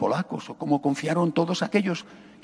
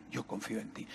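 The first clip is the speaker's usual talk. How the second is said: much softer, falling close; near-whispered prayer; 7 semitones lower, 18 dB quieter than usual. Acted. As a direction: let it sink to a whisper. Priest voices a trusting prayer.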